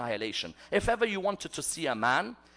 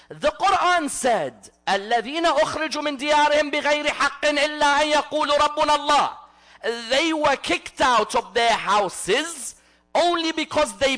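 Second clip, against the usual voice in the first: high, raised sermon voice with rapid Quran recitation; impassioned preaching; 9 semitones higher, 6 dB louder, faster than usual. Real, not acted. Preacher tells congregation of companions defending the religion, impassioned preaching. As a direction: preach it at full force, pressing each phrase on the congregation.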